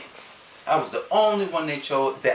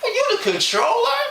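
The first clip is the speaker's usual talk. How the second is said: incredulous laugh; 6 dB louder than usual. Retold or imitated. Mocking the new controller.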